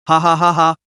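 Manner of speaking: mocking spelled-out laugh; cold bitter ha ha. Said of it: "Zhong Xian laughed bitterly".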